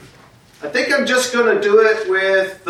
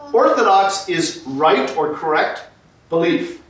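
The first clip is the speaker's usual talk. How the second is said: usual speaking voice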